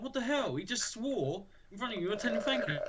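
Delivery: high, shifted voice of stunned classmates; lightly voiced classmates in mock outrage; mock shocked classmates.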